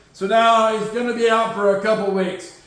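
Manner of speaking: usual speaking voice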